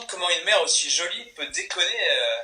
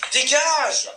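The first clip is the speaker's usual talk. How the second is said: shouting; 7 semitones higher, 4 dB louder than usual. Acted. Boss chases Marina away.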